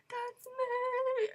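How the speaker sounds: high, loud, stretched-out cry; retold excited squeal. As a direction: squeal it, holding the last word. Retold fan calling out to band.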